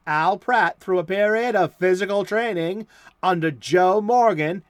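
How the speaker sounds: put-on big old-time serial announcer; dramatic blurb read. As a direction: boom out the blurb like a golden-age radio narrator. Reads the comic blurb dramatically.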